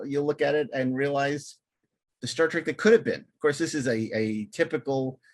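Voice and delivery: normal talking voice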